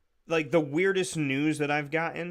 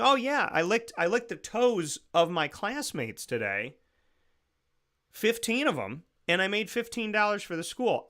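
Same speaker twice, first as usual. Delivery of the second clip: lightly voiced, higher-pitched kid; child reporting proudly; 6 semitones higher than usual. Imagined child telling dad about the fundraiser, innocently proud. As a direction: brag innocently like a schoolkid. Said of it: "they come home and they say".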